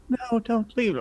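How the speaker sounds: pleading women voice. Acted. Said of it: "women are clawing at him".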